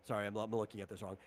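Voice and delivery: ordinary delivery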